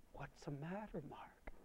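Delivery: very soft, rising mother's plea; soft pleading. Retold or imitated. Mother gently presses her crying son, soft plea. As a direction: ask gently again.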